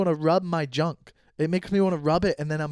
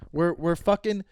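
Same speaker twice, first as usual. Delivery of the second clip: ordinary talk, nothing marked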